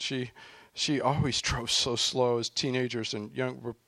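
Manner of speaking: ordinary talk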